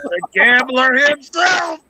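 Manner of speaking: slow, high showman introduction; hammed-up announcer reveal; mock announcer. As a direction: present him like a game-show host. Mock introducing [name].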